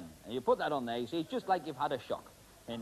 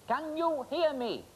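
raised voice; 12 semitones higher, slower than usual. Real, not acted. Talking to man in gas mask.

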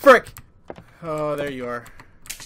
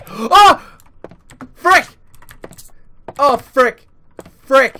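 high, loud panicked yells; scared screaming; 9 semitones higher, 10 dB louder, slower than usual. Real, not acted. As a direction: scream in real fright. Repeated jump scares.

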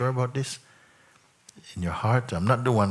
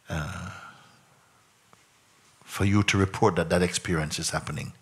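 ordinary talk

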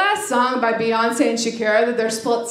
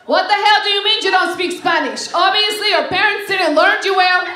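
scolding greeter; 5 semitones higher than usual. Acted. Greeter scolds her, acted.

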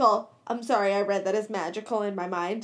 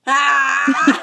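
shout; 9 dB louder than usual. Real, not acted. Reacting to Snape joke.

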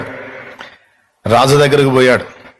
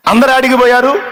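surprised question; 10 semitones higher than usual. Retold or imitated. Fool asks in surprise.

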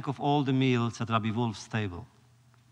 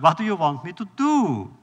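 higher, louder exasperated plea; 5 semitones higher, 7 dB louder than usual. Retold or imitated. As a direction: throw up your hands in protest. Coachman pleads, exasperated.

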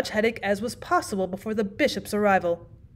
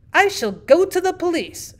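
loud, high, slow declaration; sudden energetic shout; 8 semitones higher, 5 dB louder than usual. A narrator's reading. Host threatening his guest, shouting with sudden energy. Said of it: "shouted Huddle with sudden energy".

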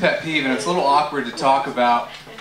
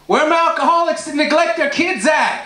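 mock crowd call; 10 semitones higher than usual.

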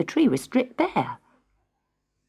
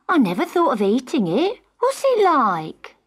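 put-on curious girl; 7 semitones higher, 4 dB louder than usual. A narrator's reading. Marion curious.

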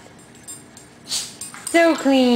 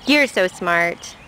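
usual speaking voice

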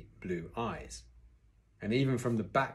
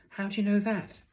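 put-on high, emphatic child's question; curious boy; 12 semitones higher, 4 dB louder than usual. A narrator's reading. Son questioning his father's claim, curious boy. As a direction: pitch right up, wanting to know.

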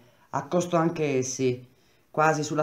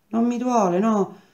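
voce alta e acuta, insistente; protesta ostinata; 8 semitones higher, 4 dB louder than usual. A narrator's reading. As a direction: nega il dolore imprecando, tono alto. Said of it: "e bestemmiava". (protesta a voce alta)

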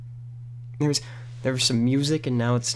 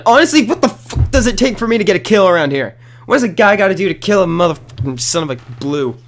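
loud, high ranting at failing to get a kill; frustrated rage; 7 semitones higher, 5 dB louder than usual. Real, not acted. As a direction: blow up in gamer rage. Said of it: "I'm losing it".

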